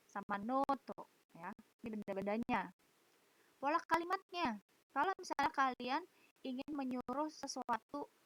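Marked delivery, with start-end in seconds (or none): none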